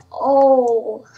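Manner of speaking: ordinary delivery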